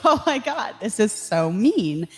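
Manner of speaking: high shocked gasp, then slow; shocked reaction. Retold or imitated. Her first shocked reaction to mean comments, high then slow. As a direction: recoil at the comments.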